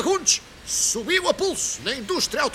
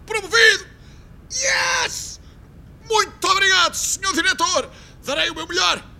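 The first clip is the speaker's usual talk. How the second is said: ecstatic cheer; 5 semitones higher than usual. Acted. Rogério hears he is promoted and thanks the director, ecstatic. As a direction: explode with joy and thank the boss.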